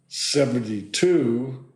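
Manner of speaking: ordinary delivery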